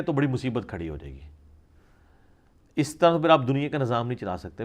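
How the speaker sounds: ordinary talk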